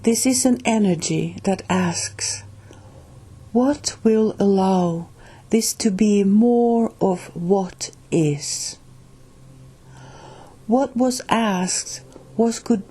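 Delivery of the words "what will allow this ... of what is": usual speaking voice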